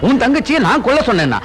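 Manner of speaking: high-pitched, agitated protest; indignant denial. Acted. Accused man denies ordering her sister's killing, indignant. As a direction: throw the accusation back, voice jumping up high.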